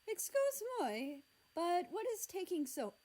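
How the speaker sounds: put-on high, light French accent; French accent, curious. French character voiced.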